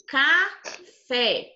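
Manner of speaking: café split as ca... FÉ, stress on fé; teacher demonstrating the strong fé in café; stressed last syllable. Shown as a demonstration. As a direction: separate the syllables of café and land on FÉ. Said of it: "O fé é a sílaba mais forte".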